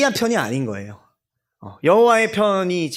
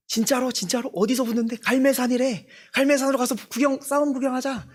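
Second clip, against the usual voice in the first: eager, higher chatter of curious onlookers; higher, half-hushed, keen to go watch the fight; excited gossip; 8 semitones higher, 3 dB quieter than usual. Acted. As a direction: townsfolk buzzing about a showdown. Imagined crowd gossiping about fight.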